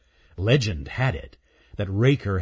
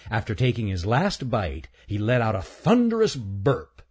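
usual speaking voice

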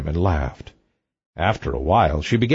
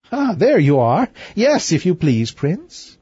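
light, higher princess voice; cartoon princess; 7 semitones higher than usual. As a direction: play a teasing young princess. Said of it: "said the princess".

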